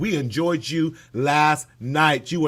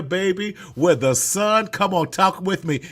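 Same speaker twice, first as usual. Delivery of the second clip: usual speaking voice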